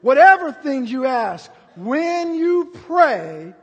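high, loud, slow thundering proclamation; preaching at full force. Acted. Preacher proclaiming Jesus' answer to Peter, thundering slowly. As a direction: boom out the words of Jesus.

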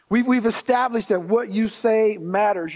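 ordinary delivery

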